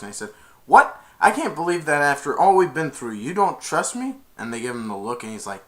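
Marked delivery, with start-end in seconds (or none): 0.6-4.1 a high, loud "what?" that settles into hurt disbelief; mock outrage. Flynn protesting to the brothers in hammed-up outrage. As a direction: play the wronged friend with big indignation.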